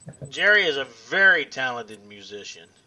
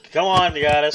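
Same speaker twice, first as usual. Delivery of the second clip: ordinary talk, nothing marked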